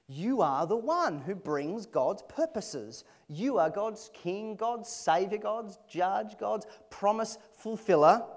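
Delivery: each title hit slow and loud; slow emphatic proclamation. Real, not acted. Preacher proclaiming Jesus's titles to the congregation, slow and rising. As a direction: build up the titles one by one, slowly.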